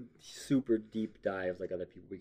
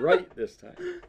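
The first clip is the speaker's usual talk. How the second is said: laughing; much slower than usual. Real, not acted. Laughing while explaining.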